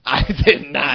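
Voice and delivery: drawn-out, protesting denial; mock-indignant denial. Real, not acted. Denying he used a slur, mock-indignant protest. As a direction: stretch every word in protest, half laughing.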